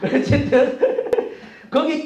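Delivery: real chuckle breaking into the words; laughing while talking. Real, not acted. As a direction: let amusement spill into the aside. 자기 설교 농담에 웃음. (웃음 섞인 말투)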